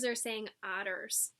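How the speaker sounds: plain reading voice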